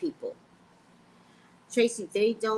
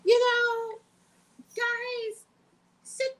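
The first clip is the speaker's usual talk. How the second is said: high put-on voice; 3 dB louder, much slower than usual.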